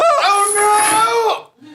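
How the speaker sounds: high, loud crying wail; anguished wail. Acted. Clerk forced to hand over his badge, loud high anguished wail. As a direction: howl as if losing everything. Clerk losing company watch.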